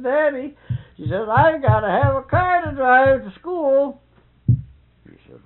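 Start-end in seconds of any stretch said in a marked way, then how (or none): 0.0-0.6 high, louder wheedling call; pleading daughter. Retold or imitated. Daughter begging her father for a car. 1.3-4.0 high, louder wheedling call; pleading daughter. Retold or imitated. Daughter begging her father for a car.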